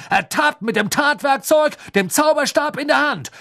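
loud, high-pitched angry accusation; ranting prosecutor's outburst; blustering. A narrator's reading. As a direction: hammer home the charge at full voice. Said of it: "polterte Mister Diggory".

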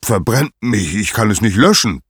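mounting, forceful outburst of a helpless god; thundering. Acted. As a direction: thunder in frustration as an acted Zeus. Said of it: "donnerte Zeus".

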